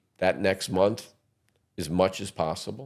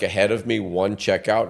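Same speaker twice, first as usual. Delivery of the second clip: ordinary talk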